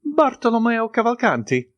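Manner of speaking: high, eager greeting; light up at hearing the name; joyful. A narrator's reading. The Italian joyfully greets the count. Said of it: "joyfully replied the Italian".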